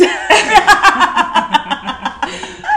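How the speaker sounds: high loud embarrassed laugh; burst of laughter. Real, not acted. Laughing at slip.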